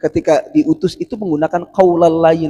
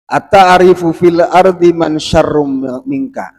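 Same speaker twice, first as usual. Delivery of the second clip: iblis asks Pharaoh; 2 semitones higher, 8 dB louder, slower than usual. Acted. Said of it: "Iblis itu ngomong ke Fa-, Fir'aun".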